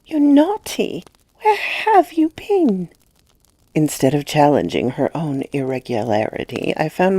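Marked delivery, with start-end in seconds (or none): 0.0-2.9 put-on high, slow little-girl scolding; a small girl chiding sweetly; high childish reproach, drawn out; child's reproach. A narrator's reading. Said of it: "addressed me with her reproach".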